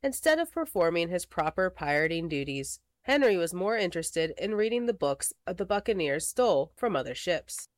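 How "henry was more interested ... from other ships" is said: ordinary delivery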